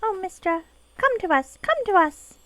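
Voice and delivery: high, sing-out fairy call; high-pitched beckoning pixie. Acted. Voices the pixies' call in the game.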